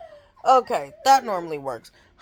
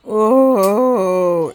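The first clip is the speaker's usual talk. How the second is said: long, loud groan of annoyance; irritated groan; 9 dB louder than usual. Real, not acted. Mother annoyed by a school call, loud groan. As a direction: let out a big exasperated "oooh".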